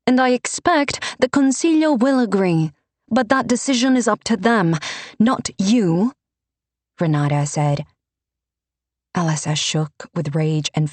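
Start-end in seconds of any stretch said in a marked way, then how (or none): 0.1-6.1 higher, firm and commanding; Renata's rebuke. Acted. Renata rebuking the priest, higher and firm. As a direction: put the priest in his place.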